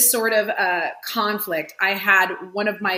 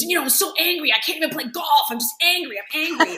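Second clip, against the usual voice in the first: higher, mildly hammed-up complaint of a fuming man; retelling the angry repairman's rant to a friend, lightly mimicked; mock angry man; 7 semitones higher than usual. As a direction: imitate the grumbling repairman, a bit pitched up. Retold angry neighbor venting.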